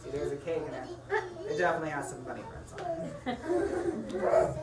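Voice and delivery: usual speaking voice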